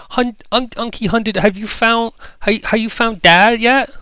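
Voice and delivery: put-on very high, stumbling child's voice, rushing to ask; eager little girl. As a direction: trip over the words like an excited toddler. Little girl asks about dad.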